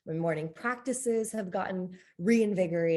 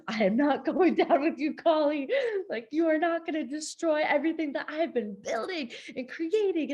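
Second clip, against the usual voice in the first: higher, hammed-up British-free defiant retort; mock defiance; 9 semitones higher than usual. She retells defying Kali to the host, higher mock defiance. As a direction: play-act standing up to a goddess, pitched higher. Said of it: "I'm like".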